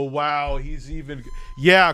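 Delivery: normal talking voice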